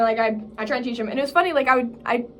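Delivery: ordinary talk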